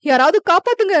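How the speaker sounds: high, loud panicked scream; terrified wife screaming for help. A narrator's reading. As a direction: cry out for rescue in terror. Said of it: "என ரமணி கத்த".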